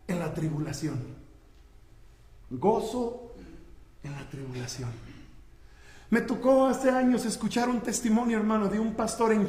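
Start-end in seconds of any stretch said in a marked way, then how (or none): 0.1-4.9 hushed emphasis. Real, not acted. Preacher repeats the key phrase to his congregation, hushed. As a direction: drop suddenly soft and low.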